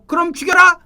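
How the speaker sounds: acting a bold, high-pitched cry; defiant shout. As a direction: shout back fearlessly at the captors. 베드로가 군인들에게 담대히 맞서는 장면. (담대하게 맞서 외치는 연기)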